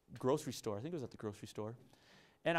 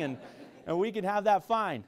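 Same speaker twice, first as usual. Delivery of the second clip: normal talking voice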